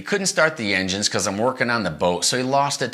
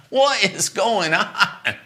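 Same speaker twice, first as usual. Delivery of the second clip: baffled; 11 semitones higher, much slower than usual. Retold or imitated. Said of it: "I'm like".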